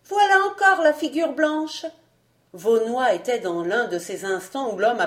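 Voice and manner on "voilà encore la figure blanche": put-on high, loud little-boy cry; child's exclamation. A narrator's reading. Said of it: "s'écria Georges".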